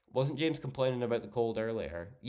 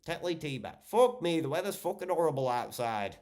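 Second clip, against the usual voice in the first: a teasing Yorkshireman moaning about the weather; Yorkshire impression; 6 semitones higher than usual. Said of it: "Or wait, you're Yorkshire".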